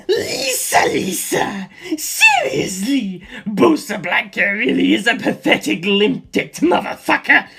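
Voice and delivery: sing-song insult; sneering villain. Acted. Antonio's taunting song.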